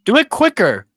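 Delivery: high, loud hurry-up call; urging shout. Real, not acted. Urging on the cracker challenge.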